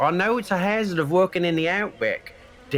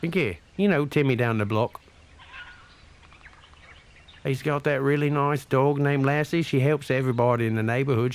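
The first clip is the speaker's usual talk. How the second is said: put-on Australian accent; 3 dB quieter than usual. Put-on Aussie accent throughout.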